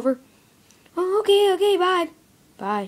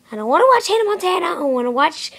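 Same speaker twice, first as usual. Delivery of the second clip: fast, high crying whine; whiny crying; 3 semitones higher, much faster than usual. Acted. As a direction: whine like a kid in a tantrum. Whining tantrum from the cat toy.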